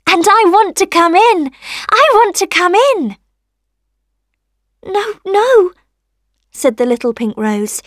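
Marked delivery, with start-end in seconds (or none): 0.1-3.2 high, louder, eager sunshine; cheery little voice. A narrator's reading. Sunshine begging rosebud to let it in, eager and cheery. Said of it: "said the cheery little voice". 4.8-5.7 high, emphatic little no; rosebud refusing. A narrator's reading. Rosebud turning sunshine away, high refusal.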